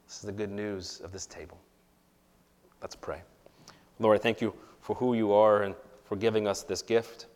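usual speaking voice

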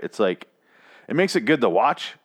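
usual speaking voice

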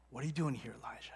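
low whisper fading on the name. Acted. God asking Elijah the same question, whispered. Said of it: "And then he whispers to him".

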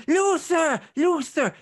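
high, loud screech for her husband; shrill wife. Acted. Wife still shouting for her husband indoors, shrill. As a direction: shriek the name like an entitled wife. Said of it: "His wife is still going".

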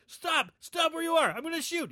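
high yelling police officer; shouting cop. Retold or imitated. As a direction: bark orders like a panicked cop. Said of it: "He's like".

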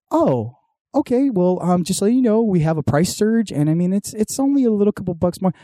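hammed-up chirpy agent easing in bad news; customer service rep. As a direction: play the rep placating an angry customer. Cable rep explaining a price surge.

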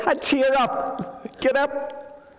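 high, slow and coaxing, imitating the crowd. The crowd urging the blind man up, high coaxing. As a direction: lift it up and coax him gently to his feet. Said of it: "The next minute they're saying".

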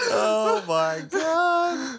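slow, high laughing. Real, not acted. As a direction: gasp it out through laughter. Laughing reaction.

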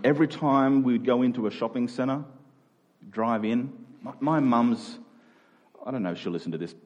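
usual speaking voice

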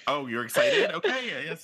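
high mock surprise, ending in a laugh; acted puzzled going-along; bemused humoring. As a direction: humor the over-excited grown-ups. Baby humoring excited parents.

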